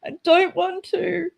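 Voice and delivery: high-pitched pleading whine to parents; retold begging. Child begging parents not to send them to school, pleading. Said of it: "I just begged my parents".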